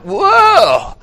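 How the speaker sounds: high, loud, falling whoa of wonder; amazed whoa. Acted. Preacher acting Jesus astonished by the centurion, high loud whoa. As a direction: let the amazement burst out in one word. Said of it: "He was like".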